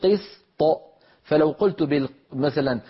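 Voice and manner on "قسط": "قسط" ending in a single light bounce of ط; qalqala on ط. Shown as a demonstration. Teacher demonstrates the correct ط release. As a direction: release the ط of "قسط" by parting the tongue. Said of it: "باعدت بين اللسان وغار الحنك بصوت".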